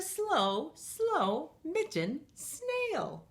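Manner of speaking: stretched out, very slow snail words; drawn out slow. A narrator's reading. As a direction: drag it out like a snail. Reads slow snail line.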